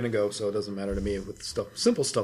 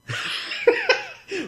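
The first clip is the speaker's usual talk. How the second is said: very high burst of laughter; big laugh. Real, not acted. Co-host bursts out laughing at the joke. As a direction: crack up completely.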